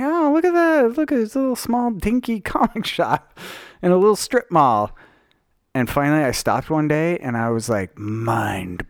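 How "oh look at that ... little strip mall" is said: much higher, delighted mock voice; high cutesy surprise. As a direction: coo over the tiny shop. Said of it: "I'm like".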